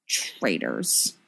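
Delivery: low, soft, sour mutter; sourly. A narrator's reading. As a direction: grumble it bitterly under your breath. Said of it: "Sirius thinks sourly".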